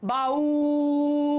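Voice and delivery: "বাউল" with a very long level উ; stretched vowel. Shown as a demonstration. Teacher contrasting with the short word, "বাউল" stretched. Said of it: "এবার তুমি এখানে দেখো".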